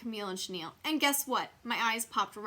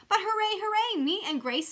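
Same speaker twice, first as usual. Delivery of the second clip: cheering; 11 semitones higher, 6 dB louder than usual. A narrator's reading. Narrator cheering.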